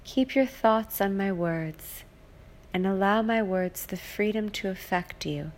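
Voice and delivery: ordinary talk, nothing marked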